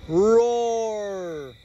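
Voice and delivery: storyteller's roar; monster roar. A narrator's reading. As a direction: roar it out like a beast.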